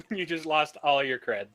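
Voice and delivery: ordinary talk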